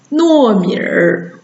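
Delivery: 糯米儿 slow, 米儿 blended into one syllable; erhua demo. Shown as a demonstration. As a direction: draw out 糯米儿 with curled r ending. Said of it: "直接加儿一起读".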